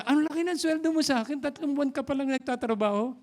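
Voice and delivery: fast, high amazed exclamation; surprised brother. Retold or imitated. Brother shocked at younger sibling's salary, fast and high. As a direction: act out the older brother gasping at the bigger salary.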